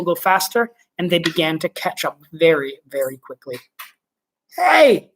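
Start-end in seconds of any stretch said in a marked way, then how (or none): 4.6-5.1 sharp high yell of alarm. Acted. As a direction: shout it out in alarm. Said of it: "yelled Showler".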